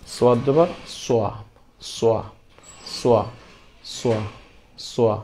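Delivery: heavy swa on each সোয়া; rounded sad. Shown as a demonstration. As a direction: round the lips for every সোয়া, said separately. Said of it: "আপনার মুখ গোল হয়ে যাবে". (মুখ গোল করে ভারী সোয়া)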